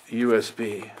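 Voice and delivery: normal talking voice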